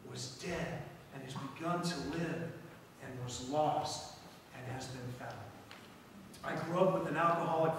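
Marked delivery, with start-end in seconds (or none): 0.1-5.3 tender appeal. Acted. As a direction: plead gently with the son. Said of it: "is appealing to the son".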